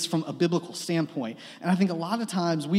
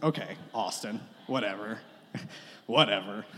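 grudging whatever; 9 semitones lower, 8 dB quieter than usual. Retold or imitated. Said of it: "I'm like".

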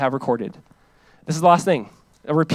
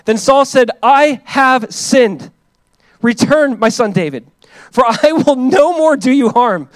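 loud, high, proclaiming Bible reading; fervent scripture reading; 8 semitones higher, 9 dB louder, slower than usual. Acted. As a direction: read it out like from the pulpit. Continues reading Saul's words.

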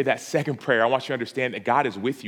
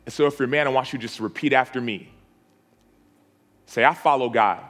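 call-and-response prayer. Acted. Pastor leading the men in a repeat-after-me declaration.

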